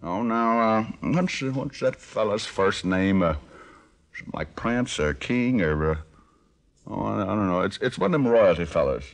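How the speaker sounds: put-on Arkansas country accent. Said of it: "How about a Swedish dialect?".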